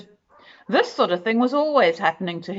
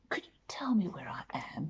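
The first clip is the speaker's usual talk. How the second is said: fast, hushed, amazed whisper; 14 dB quieter, faster than usual. A narrator's reading. Mister Good asks the woman where he is, in a quick whisper. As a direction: ask under your breath in wonder.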